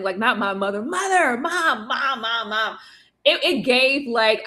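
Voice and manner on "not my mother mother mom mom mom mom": mock yelling. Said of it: "he's just yelling".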